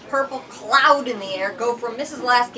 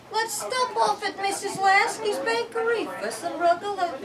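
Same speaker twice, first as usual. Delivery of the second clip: raised, eager mother voice; mother's voice; 10 semitones higher than usual. A narrator's reading. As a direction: call it out like a busy mother. Mother voiced in read story.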